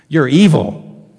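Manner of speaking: blunt accusation. Acted. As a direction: pronounce it slowly and loudly, as a verdict. Preacher voices Jesus' blunt verdict.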